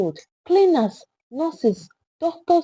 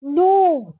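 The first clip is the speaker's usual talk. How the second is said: louder anguished no; 6 semitones higher, 3 dB louder than usual. A narrator's reading. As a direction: raise it in disbelief. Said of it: "then louder".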